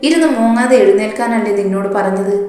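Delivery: ordinary delivery, nothing marked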